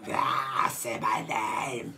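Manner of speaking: slow raspy smoker's croak; smoker's rasp. Retold or imitated. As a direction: drag it out like a heavy smoker. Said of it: "she's got that smoker's rasp".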